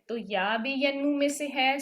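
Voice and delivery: ordinary delivery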